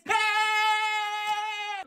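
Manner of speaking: high, drawn-out cheer. Acted. Pupils hearing vacations are coming, cheering. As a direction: celebrate with a long high "eeeh".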